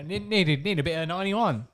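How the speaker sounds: ordinary talk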